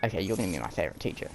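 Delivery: lightly voiced quick decision; quick aside. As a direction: rattle it off under your breath. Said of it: "I'm like".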